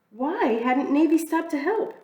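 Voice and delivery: ordinary delivery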